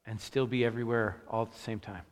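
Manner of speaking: quiet pastor's prayer. Acted. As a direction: pray it quietly and reverently. Opening prayer before the sermon.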